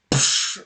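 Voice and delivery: voiceless burst of impact; crashing noise. Acted. Game master shows body flying through cavern roof, imitated crash. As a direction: imitate something smashing through rock.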